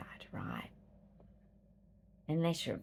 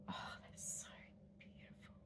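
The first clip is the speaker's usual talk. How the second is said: whispering; 17 dB quieter than usual. Retold or imitated. Quoted inner thought by stream.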